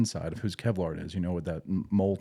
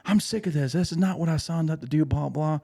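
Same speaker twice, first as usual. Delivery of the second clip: upset marine; 7 semitones higher than usual. Retold or imitated. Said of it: "He's like".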